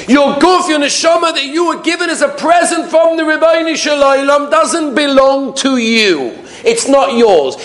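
high, slow sermon declamation; impassioned preaching. Real, not acted. Rabbi teaching that body and soul are not ours, impassioned preaching. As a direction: declaim it slowly with rising force.

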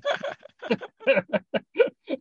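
high, rising laughter that won't stop; real laugh. Real, not acted. Still laughing at his own punchline to the host, high laughter. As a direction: let the laugh keep bubbling up. Keeps laughing after the joke.

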